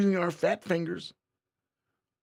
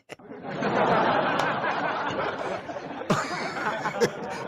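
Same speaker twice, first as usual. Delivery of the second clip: long laugh; 8 semitones higher than usual. Real, not acted. Laughs at Kelly.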